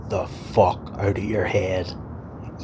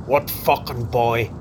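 angry; 6 semitones higher than usual. Acted. Boss angrily dismissing him.

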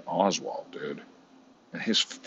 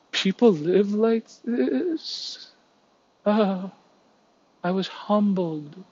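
much higher, slow, breathy-put-on celebrity voice; mocking Chelsea Handler; 14 semitones higher, much slower than usual. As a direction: drag it out, a mock-humbled rich star. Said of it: "She's all".

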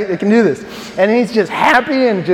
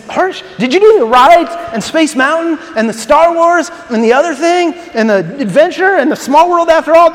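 exasperated shout; 7 semitones higher, 4 dB louder than usual. Retold or imitated. Coworker exasperated at Herschel's trip.

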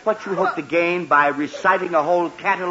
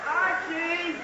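calling from afar; 11 semitones higher, 8 dB quieter than usual. Acted. Calling son from upstairs.

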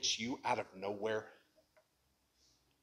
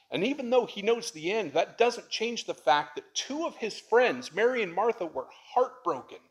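impassioned preaching; 9 semitones higher, 6 dB louder than usual. Acted.

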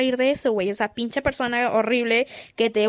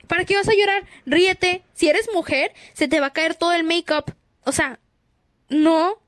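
ordinary delivery

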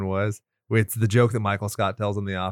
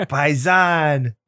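high, mock New York Italian; New York Italian accent; 4 semitones higher, 3 dB louder than usual. Co-host capping the Brody bit, New York Italian accent. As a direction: toss it out like a hammy New York paisan. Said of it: "I wish that was the way he talked".

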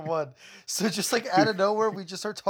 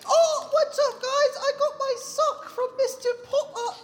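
Dobby voice. Acted. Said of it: "we have Dobby".

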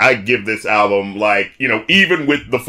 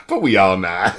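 low sighing delivery; 5 semitones lower than usual. Retold or imitated. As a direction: breathe out and sink the line. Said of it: "it's the way he says".

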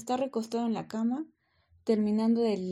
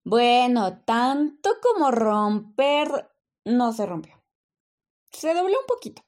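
defensive; 7 dB louder than usual. Acted. Death excuses broken drainpipe.

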